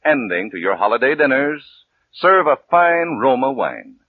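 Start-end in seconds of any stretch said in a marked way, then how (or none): none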